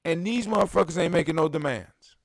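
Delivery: normal talking voice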